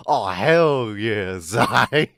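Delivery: loud, drawn-out mock nasal VTuber cheer; slow, loud, put-on nasal whoop; nasally VTuber. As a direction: ham up a nasally streamer hype voice. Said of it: "gonna be the next nasally VTuber".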